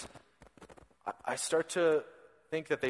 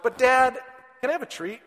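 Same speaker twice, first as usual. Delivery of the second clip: put-on much higher, louder little-boy plea; whiny toddler; 10 semitones higher, 6 dB louder than usual. Father imitating his son asking for lunch, high whine. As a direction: nag for lunch like a small child.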